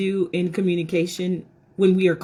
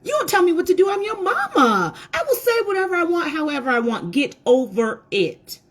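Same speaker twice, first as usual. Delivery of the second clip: higher and sharper, a mama laying down the law; harsh mama scolding; 6 semitones higher than usual. Retold or imitated. Mother shutting down her daughter's complaint, harsh scolding.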